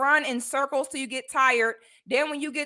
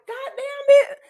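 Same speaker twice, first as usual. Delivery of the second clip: higher, louder mock groan of annoyance; fed-up exclamation; 12 semitones higher, 4 dB louder than usual. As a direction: act out being woken at night. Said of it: "I be like".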